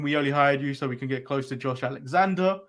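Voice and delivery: normal talking voice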